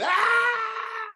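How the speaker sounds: high piercing mock child scream; child's scream. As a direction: shriek like a five-year-old for no reason. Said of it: "when a child screams".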